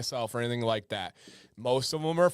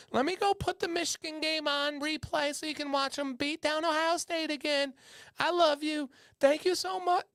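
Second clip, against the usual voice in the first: high, doting falsetto; hammed-up apologetic wife, melting in falsetto; gushing wife impersonation. Wife won over by a gift pampers husband, gushing falsetto. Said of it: "then she's like".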